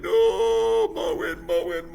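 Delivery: high wailing cry. Acted. Winona crying to the west wind, loud wail. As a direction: wail it out, heartbroken. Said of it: "she was crying".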